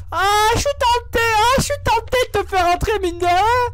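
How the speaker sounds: voix de tête aiguë et amusée, un peu forte; voix de personnage. Pretend. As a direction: joue un portier séducteur en voix aiguë. Joue le portier en voix aiguë. (voix aiguë de personnage)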